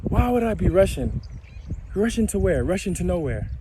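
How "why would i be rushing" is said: ordinary delivery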